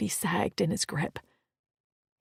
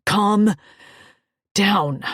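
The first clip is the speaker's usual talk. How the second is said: firm command; 11 dB louder than usual. Acted. Holding her down firmly.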